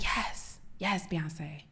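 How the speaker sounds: hushed, whispered fan cheer; under-the-breath whispered adoration; retold whisper. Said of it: "we're both whispering it".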